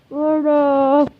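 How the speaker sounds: loud greeting. Real, not acted. Shouted greeting.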